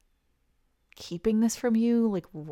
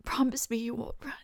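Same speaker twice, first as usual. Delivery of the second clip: soft whisper, pleading; whispered plea; 6 dB quieter than usual. Acted. Friend asking him not to leave, whispered. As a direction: breathe the promise request.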